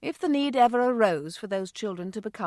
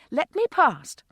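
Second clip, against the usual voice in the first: a firm, higher and louder order; 7 semitones higher, 6 dB louder, slower than usual. A narrator's reading. Mother pushes past her children to the carriage, firmly. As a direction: clear the way, no arguing. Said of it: "Lydia said firmly".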